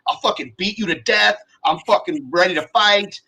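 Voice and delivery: a hammed-up furious yell; imitated rage. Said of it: "and you're like".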